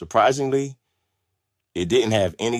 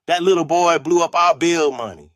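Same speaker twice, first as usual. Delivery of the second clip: high, loud neighbor's accusation; angry yell; 8 semitones higher, 5 dB louder than usual. A narrator's reading. Angry neighbor accusing the boy to grandmother, yelled. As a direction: yell it out at the doorstep. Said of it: "someone yelled".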